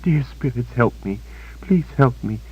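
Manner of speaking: high, frightened repeated mumbling; high whimpering mumble; mumbled plea. A narrator's reading. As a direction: mumble a prayer for help over and over. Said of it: "she mumbled over and over".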